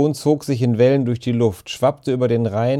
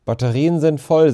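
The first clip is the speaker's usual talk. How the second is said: louder, higher, mildly indignant protest; slightly wounded pride; 4 semitones higher, 5 dB louder than usual. A narrator's reading. Boy defends his flashlight's batteries, slightly wounded pride. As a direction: defend yourself, a touch offended. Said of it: "leicht verletzt in seiner technischen Ehre".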